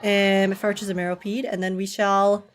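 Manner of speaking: ordinary delivery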